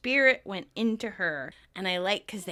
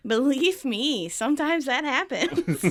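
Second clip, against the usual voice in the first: Chandler voice; 5 semitones higher, 5 dB louder, slower than usual. Retold or imitated. Chandler quote acted.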